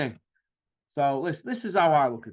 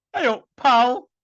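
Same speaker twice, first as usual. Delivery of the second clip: "Hey up, pal." higher and louder, mock Yorkshire greeting; Ross Birkinshaw impression; 7 semitones higher, 6 dB louder, slower than usual. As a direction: greet like a cheery northern mate. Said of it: "Sound like Ross Birkinshaw".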